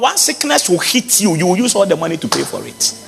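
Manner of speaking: ordinary talk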